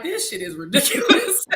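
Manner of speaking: mock the editors while cracking up; laughing quote. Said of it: "they were like".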